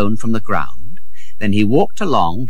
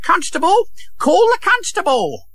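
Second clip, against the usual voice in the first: high-pitched woman's outcry; shrieky alarm without much volume; crying out. Acted. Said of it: "she cried".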